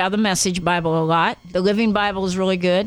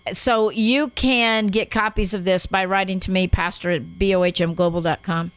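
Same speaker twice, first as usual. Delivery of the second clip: normal talking voice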